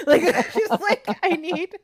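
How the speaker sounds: giggly high voice; laughing. Real, not acted. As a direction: laugh through it.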